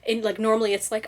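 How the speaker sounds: usual speaking voice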